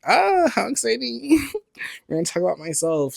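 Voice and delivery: mock excitement. Sarcastic fake excitement.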